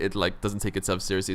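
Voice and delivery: usual speaking voice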